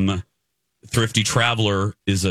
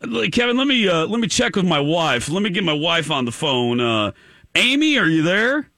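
put-on manly caller, pitched up; 8 semitones higher than usual. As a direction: play an eager prank caller in a raised manly voice. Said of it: "that was my manly voice".

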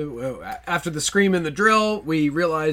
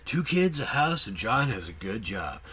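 movie trailer voice; 5 semitones lower, 5 dB quieter than usual. Shown as a demonstration. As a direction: read it like an epic trailer. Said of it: "I want you to read like the movie trailer guy".

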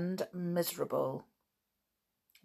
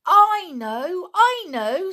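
much higher, loud eager cry; sudden excitement; 12 semitones higher, 9 dB louder, slower than usual. A narrator's reading. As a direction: burst out with it. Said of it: "said George suddenly".

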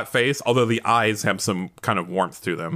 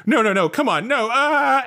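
mock panic; 11 semitones higher than usual. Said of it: "he goes like".

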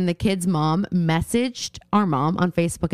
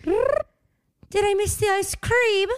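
put-on very high, slow accented quote with a trilled R; put-on accent, stretched out and high; accent with rolled R; 12 semitones higher, much slower than usual. Co-host showing how the quote goes, exaggerated accent. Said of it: "You didn't roll your R".

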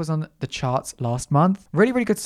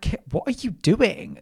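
higher-pitched incredulous self-telling-off; mock self-scolding; 6 semitones higher than usual. Host tells themself off for slipping into an accent, mock scolding. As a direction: scold yourself in mock disbelief.

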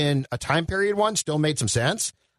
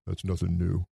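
low, soft, flat-voiced Rambo line; deadpan Rambo; 10 semitones lower, 6 dB quieter than usual. Mocking Rambo's weary line to the co-hosts, low and soft. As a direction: mutter it like a weary tough guy.

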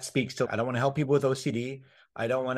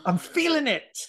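much higher, hammed-up ecstatic voice; mock rapture; 13 semitones higher than usual. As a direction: play it like a revival-tent convert feeling the spirit. Mock awakening after coaching joke.

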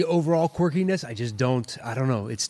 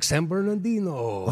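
comedian character punchline; 4 semitones higher, slower than usual. Retold or imitated. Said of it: "George Lopez meets, uh, Larry the Cable Guy character".

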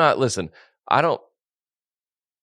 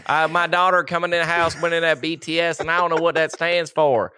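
higher-pitched Southern parent voice; Southern accent; 5 semitones higher than usual. Pretend. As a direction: play a worried parent with a thick Southern accent. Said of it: "We've done a Southern accent".

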